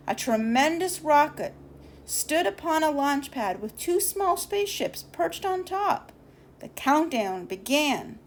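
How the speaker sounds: plain reading voice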